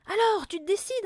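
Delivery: high, loud demand; impatient insistence. A narrator's reading. Mother to her hesitant husband, impatient insistence. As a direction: press hard for an answer. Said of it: "insista la mère".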